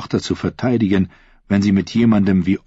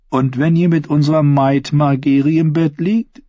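high, playful teasing question; 8 semitones higher, 5 dB louder than usual. A narrator's reading. Queen to her new lover, teasing. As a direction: tease him with a lilting high voice. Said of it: "fragte sie neckisch".

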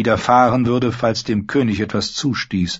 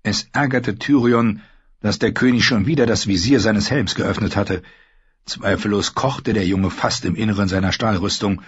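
plain reading voice

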